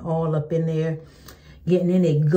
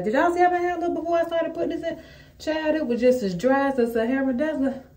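dramatic exclaim; 9 semitones higher than usual. Real, not acted. Exaggerated complaint about dry hair.

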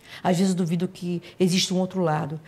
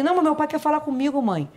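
put-on high, louder little boy begging; child pleading; 9 semitones higher, 3 dB louder, faster than usual. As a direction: protest like a scared small child. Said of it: "son pleading to stay".

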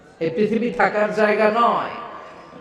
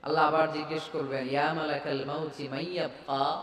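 much softer near-whispered telling; hushed telling; 2 semitones lower, 13 dB quieter than usual. A narrator's reading. Preacher hushing as only the angel of death remains, near-whisper. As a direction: drop the voice to a hush.

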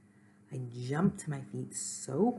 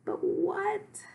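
very high, loud outburst; distressed cry; 6 dB louder than usual. A narrator's reading. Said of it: "cried Gorp".

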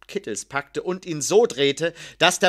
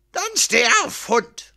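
high-pitched, forceful angry order; thundering; 8 semitones higher, 4 dB louder than usual. A narrator's reading. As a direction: thunder it at the man on the floor. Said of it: "donnerte Posthumo". (donnernder Befehl)

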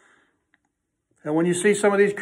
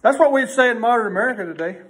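usual speaking voice

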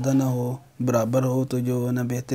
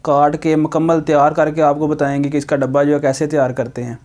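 louder, upbeat presenter read for the video intro; channel intro voice; 4 semitones higher, 7 dB louder than usual. Acted. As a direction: pitch it like a host opening the show to viewers. Energetic YouTube intro voice. (जोशीले अंदाज़ में video की शुरुआत)

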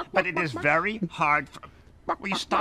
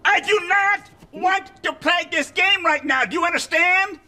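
exasperated shout; 9 semitones higher, 4 dB louder than usual. Acted. Bert finally loses patience.

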